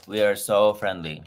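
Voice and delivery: ordinary talk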